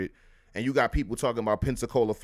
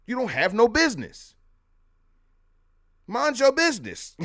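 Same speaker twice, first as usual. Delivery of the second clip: high, sassy dismissal that lands on your; sassy put-down; 10 semitones higher, 4 dB louder than usual. Acted. Telling would-be speakers with no business to back off, sassy and higher. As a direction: shut the wannabe speaker down with attitude.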